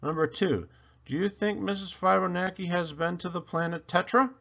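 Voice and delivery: plain reading voice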